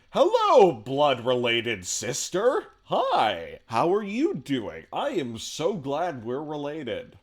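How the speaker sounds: high, loud, slow hammed-up sibling greeting; hammed-up stiff greeting, every word overplayed; mock formal greeting. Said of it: "I don't go like".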